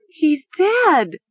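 high, horrified shriek; screaming. A narrator's reading. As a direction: shriek it out in shock. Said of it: "she heard herself screaming".

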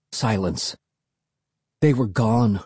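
ordinary delivery, nothing marked

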